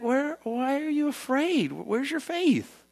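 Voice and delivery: high, slow and tender reproach; gently rebuking Jesus. Retold or imitated. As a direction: ask it like a patient teacher. Jesus rebukes the frightened disciples.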